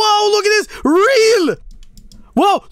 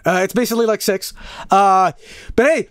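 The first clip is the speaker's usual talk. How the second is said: ordinary talk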